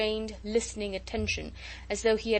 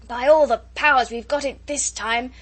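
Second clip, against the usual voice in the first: loud, raised howl into the gale; 4 semitones higher, 9 dB louder than usual. A narrator's reading. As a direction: howl it, loudest on the opening words. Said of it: "observed Jukes in a howl".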